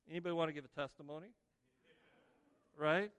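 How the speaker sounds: ordinary talk, nothing marked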